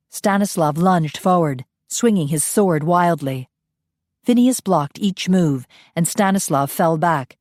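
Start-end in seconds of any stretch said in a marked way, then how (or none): none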